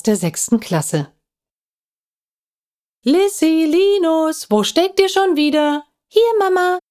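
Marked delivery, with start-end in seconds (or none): 3.0-5.8 a mother's high sing-out call. A narrator's reading. Mother calls out for her children. 6.1-6.8 put-on high, child's call back to mother; high-pitched shouted reply. A narrator's reading. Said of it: "rief Lissy".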